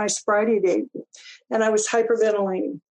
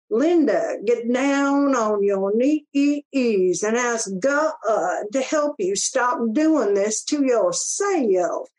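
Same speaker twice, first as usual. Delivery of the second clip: slow, drawn-out Southern drawl; Southern accent; 2 semitones higher, much slower than usual. Retold or imitated. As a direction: imitate a tough Southern woman, stretching the words. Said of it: "Louise had a Southern accent".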